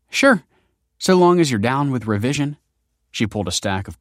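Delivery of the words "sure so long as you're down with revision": much higher, louder bright sure; a lightly voiced eager yes; cheerful agreement. A narrator's reading. Young woman agreeing to be walked home, cheerful.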